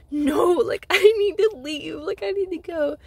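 loud, high retold plea to go; distressed protest. Patient insisting to staff she must leave, loud distressed protest. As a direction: raise your voice, pleading to get out.

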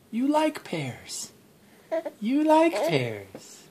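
put-on high slow sing-song baby talk. Coaxing baby to eat.

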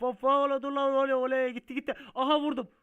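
very loud, high crash panic; panicked yelling. Real, not acted. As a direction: yell as the truck gets away. Oyunda kontrolü kaybedip çarpıyor. (panikle tiz sesle bağırarak)

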